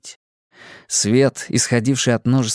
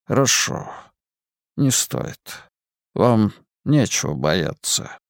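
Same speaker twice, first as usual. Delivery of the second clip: eldritch horror; slower than usual. Acted. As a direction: speak from far in darkness. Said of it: "Он звучал приглушённо".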